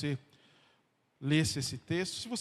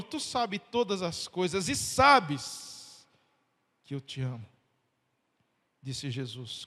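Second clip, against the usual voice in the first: scripture reading; 4 dB louder than usual. Acted. Reading ends, quieter.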